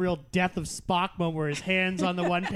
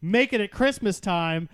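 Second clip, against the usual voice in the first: normal talking voice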